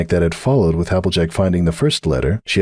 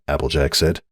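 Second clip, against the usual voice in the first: ordinary delivery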